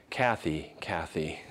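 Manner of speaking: tender, tearful. Pretend. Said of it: "with a tear in your eye saying".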